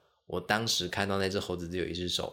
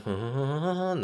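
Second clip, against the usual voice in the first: mock sobbing whimpers climbing higher; rising whimper; 7 semitones higher than usual. Telling how her crying kept climbing, mock rising whimper. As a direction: whimper up a scale like crying anew. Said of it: "我那个音阶就这样继续爬起来".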